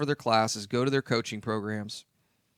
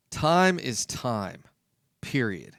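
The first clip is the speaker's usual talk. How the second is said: slow emphatic statement; much slower than usual. Shown as a demonstration. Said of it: "emphatic slow restatement".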